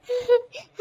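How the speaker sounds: high mocking cackle; mocking laugh. Aunt laughing at her niece's misfortune, mocking cackle. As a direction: laugh at the girl behind her back. Aunts mock the girl.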